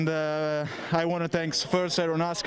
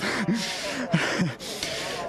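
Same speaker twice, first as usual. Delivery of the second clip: breathless laugh; 4 semitones higher than usual. Real, not acted. Breathless driver laughs after race.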